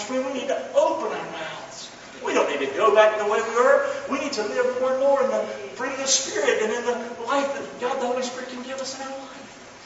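ordinary delivery

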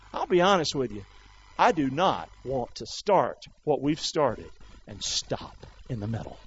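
ordinary delivery

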